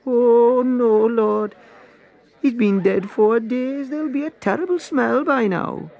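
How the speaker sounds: high, loud, dismayed protest; alarmed Martha. A narrator's reading. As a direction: recoil at the thought of the smell. Martha objecting to opening tomb.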